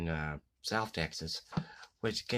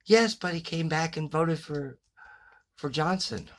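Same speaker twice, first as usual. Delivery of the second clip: higher, louder mock kid voice delivering the punchline; lightly hammed boy, innocent and matter-of-fact; little boy punchline; 8 semitones higher, 7 dB louder than usual. Boy explains dead grandfather voted for Johnson, mock kid voice.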